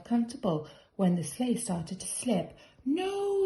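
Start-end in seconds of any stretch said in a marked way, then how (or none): 2.8-3.4 much higher panicked yell; sudden alarmed cry of no. A narrator's reading. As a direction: shout to stop the sliding sleigh. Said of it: "yelled the man".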